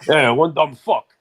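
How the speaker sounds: low, slow, loud comic impression; mock old-school comic delivering a punchline; Rodney Dangerfield. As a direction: punch it out low like a comedian cueing his band. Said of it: "Except it's like Rodney".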